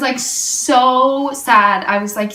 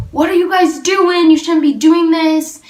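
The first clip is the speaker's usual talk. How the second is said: retold yell; 6 semitones higher, 4 dB louder than usual. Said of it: "yelled at us".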